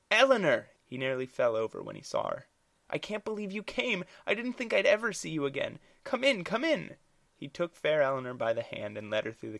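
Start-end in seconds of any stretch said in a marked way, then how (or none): 0.1-0.7 high, loud shocked call of her name; startled cry. Acted. Thomas crying out on seeing his old love. 2.9-7.0 high, eager, rushed greeting; nervously thrilled host; flustered welcome. Acted. Thomas ushering Eleanor in, high and flustered.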